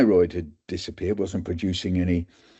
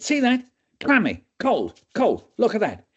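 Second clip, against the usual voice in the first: demonstrating doctor; 12 semitones higher than usual. Retold or imitated. Doctor shows students the patient, high. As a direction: point out each sign.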